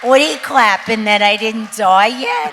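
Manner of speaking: shouted laughing quip over the clapping; loud joking over applause. Real, not acted. Comedian teasing the applauding audience, loud laughing quip. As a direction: call out over the crowd, laughing.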